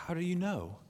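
put-on high-pitched young questioner; bewildered boy. A narrator's reading. Boy asks the unseen voice how it knows, bewildered. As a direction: ask it high and baffled. Shasta challenges the voice.

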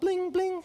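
high-pitched "bling, bling" chime; sing-out computer ping; imitated message alert. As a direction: mimic a chat notification pinging, high up. Mimics Skype message alert.

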